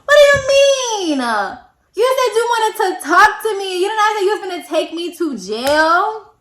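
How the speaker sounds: high, loud, crying protest; outraged disbelief. Retold or imitated. To the officer arresting her, a loud, crying, outraged protest. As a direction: wail it at the cop, stretching the words.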